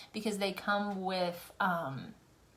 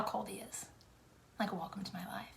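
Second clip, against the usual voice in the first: hushed sarcastic aside; whispered aside; 13 dB quieter than usual. Real, not acted. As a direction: whisper it dryly. Whispered complaint.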